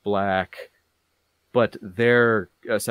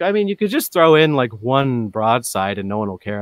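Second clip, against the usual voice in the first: mock-objector voice, pitched up at first; imitating persuaders; 3 semitones higher, 6 dB louder than usual. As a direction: mimic someone pushing a suggestion. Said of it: "so many people be like".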